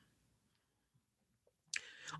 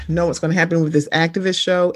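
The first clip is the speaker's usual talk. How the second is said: ordinary talk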